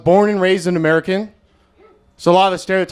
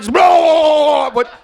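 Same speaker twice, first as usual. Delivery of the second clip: high, slow, laughing blah noises; mock slurping blah; 6 semitones higher than usual. Comic imitating the sound of oral sex, with high, slow, laughing blahs. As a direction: imitate going to town with silly blahs. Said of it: "that's what it sounds like".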